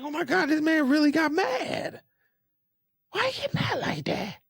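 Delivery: high, squeaky-pitched retold astonishment; amazed disbelief. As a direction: replay your disbelief way up high. Acts out own amazed reaction.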